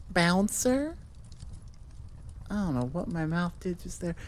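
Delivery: normal talking voice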